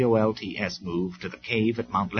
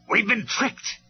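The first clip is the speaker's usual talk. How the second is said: much higher furious cry; storming; 13 semitones higher, 4 dB louder, slower than usual. A narrator's reading. Megatron storming by his burning fortress. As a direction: rage at being outwitted. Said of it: "he stormed".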